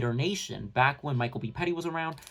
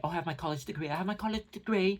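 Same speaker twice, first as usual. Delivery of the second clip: put-on self-important voice, rising higher; mocking boast; 4 semitones higher, 3 dB quieter than usual. Commentator imitates Rachel bragging about her degree, mocking. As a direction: parody someone showing off an education. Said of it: "talking about how she's educated".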